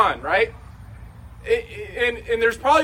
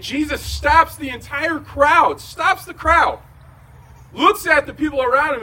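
preaching shout; 7 semitones higher, 5 dB louder than usual. Acted. Preacher building to the climax.

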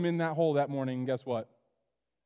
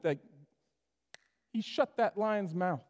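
ordinary talk, nothing marked

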